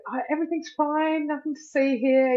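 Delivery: mock cheerful "all fine" act, hiding the crying; all energised. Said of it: "all energised".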